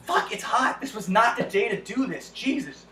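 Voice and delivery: ordinary delivery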